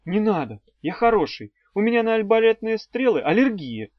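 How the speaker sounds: half-joking plea to be spared; pleading. A narrator's reading. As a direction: wheedle your way out of punishment. Pupil pleads after a threat.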